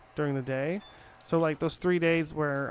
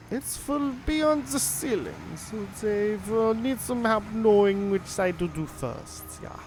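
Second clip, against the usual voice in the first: a mock German accent, pitched higher; hammed-up German accent, polite and cheerful; 6 semitones higher than usual. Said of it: "It's not my best German accent".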